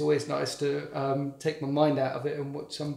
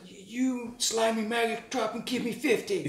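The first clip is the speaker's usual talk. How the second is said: barking American drill sergeant; American accent; 8 semitones higher than usual. Retold or imitated. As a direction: shout it like a US staff sergeant. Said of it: "That's my American accent".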